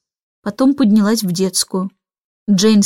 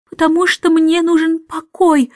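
higher, cutting retort; curt reply; 6 semitones higher than usual. A narrator's reading. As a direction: cut them off sharply. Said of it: "отрезала миссис Бэнкс". (резко отрезать детям)

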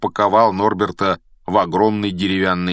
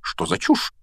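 indignant; 14 semitones higher than usual. A narrator's reading. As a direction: dismiss it in a high, affronted voice. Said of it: "возмутилась профессор МакГонагал". (возмущённо, высоким голосом)

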